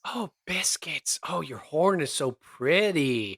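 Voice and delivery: slow, whispery awestruck cooing; adoring coo. Acted. Tiefling marvelling at Biscuit's horn, slow adoring coo.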